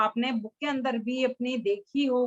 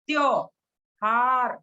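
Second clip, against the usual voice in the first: त्योहार stretched out very slowly; slow demonstration; much slower than usual. Shown as a demonstration. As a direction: model त्योहार slowly for the class. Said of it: "इसको कैसे बोलेंगे हम?". (त्योहार धीरे-धीरे बोलकर दिखाना)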